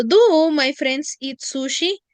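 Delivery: projected teacher model; emphatic model question. Shown as a demonstration. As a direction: announce the question to the class. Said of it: "¿no es cierto?".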